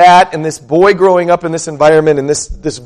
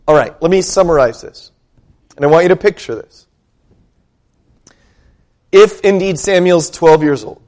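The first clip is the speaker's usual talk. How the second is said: ordinary delivery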